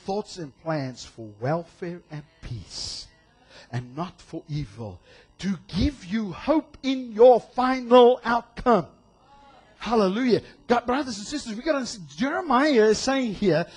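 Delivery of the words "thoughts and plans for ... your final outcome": a gentle Bible reading; slow scripture reading. Acted. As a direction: lay each word down slowly. Reads scripture slowly.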